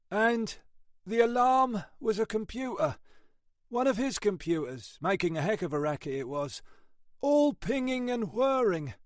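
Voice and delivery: a tired British copper reporting grimly; weary detective sergeant. Acted. Ashford describes the body.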